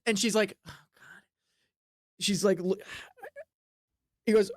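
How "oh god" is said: exasperated whisper. Acted. She groans at him.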